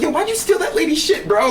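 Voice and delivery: high-pitched mock bro taunt; mock message to a stranger. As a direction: needle a stranger in a goofy high voice. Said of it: "I would Facebook the people and be like".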